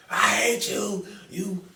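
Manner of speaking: high, loud wailing rant; hammed-up hysterical screech at a partner; mock meltdown, ending in wailing; Tommy. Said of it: "Tommy was like".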